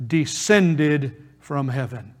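ordinary delivery

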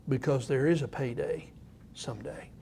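someday fading to a bare hush; ominous hush. Acted. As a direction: drop to a soft, grave warning. Ominous warning dropping to hush.

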